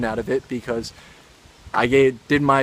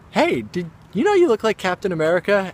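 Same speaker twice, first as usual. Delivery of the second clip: higher-pitched quoted question; imitated actress; 8 semitones higher than usual. Actress to him at the photo shoot, imitated higher voice. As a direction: put on her pitched-up voice for the remark.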